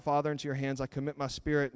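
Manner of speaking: ordinary delivery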